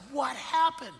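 high, amazed retold question; astonished question. Wife astonished at changed daughter, retold.

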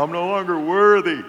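hammed-up penitent son quote; mock prodigal son. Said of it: "right out of the story of the prodigal son".

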